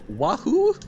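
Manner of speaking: a bright Mario-style yahoo; mock Mario cheer. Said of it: "I unironically said".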